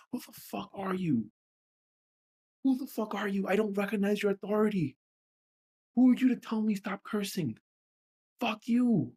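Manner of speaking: high, indignant challenge; angry confrontation. Real, not acted. Confronts remembered critics, telling them off.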